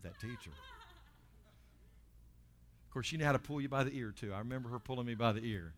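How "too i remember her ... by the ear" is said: ordinary talk, nothing marked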